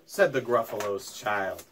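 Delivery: ordinary delivery, nothing marked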